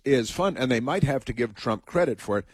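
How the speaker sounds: normal talking voice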